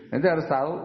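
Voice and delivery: ordinary talk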